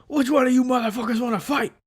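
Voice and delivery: high, loud mock tough-guy yell; hammed-up provocation from a stranger; aggressive challenge. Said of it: "and he's like".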